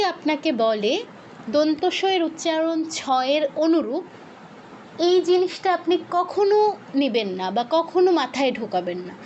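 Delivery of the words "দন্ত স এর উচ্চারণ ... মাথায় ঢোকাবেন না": ordinary delivery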